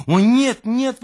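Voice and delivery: high, loud cry of denial; exclaiming no. Acted. Louise crying out to the narrator, high and loud. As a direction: cry out to calm herself. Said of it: "воскликнула она".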